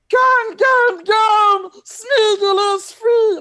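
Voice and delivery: very high, loud creature cheer; Smeagol. Acted. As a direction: crow it slowly, liberated and jubilant. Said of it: "And he was liberated".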